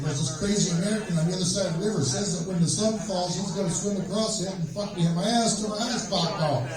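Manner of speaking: rapid, run-on alarmed explanation; rattled report. Acted. German soldier explaining himself to his commanding officer, rattled report. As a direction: rush it out as a panicked excuse.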